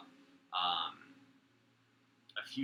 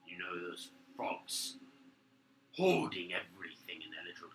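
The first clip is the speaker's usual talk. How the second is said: guard griping; 2 semitones lower, 7 dB louder than usual. Acted. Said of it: "He goes".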